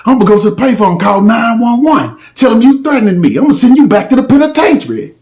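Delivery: acting out the angry guy's threat; mimicked threat.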